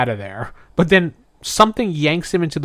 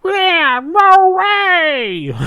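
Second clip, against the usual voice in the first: much higher, cartoon unicorn squeak; squeaky Uni voice; 5 dB louder, much slower than usual. Host voicing the unicorn Uni, much higher and squeaky.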